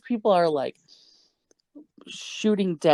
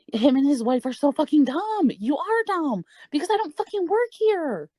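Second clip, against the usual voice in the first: high-pitched, worked-up exasperation; exasperated rant; 11 semitones higher than usual. Real, not acted. Storyteller venting about rude customers, high and exasperated. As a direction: vent in high-pitched disbelief. Ranting about the couple's stupidity.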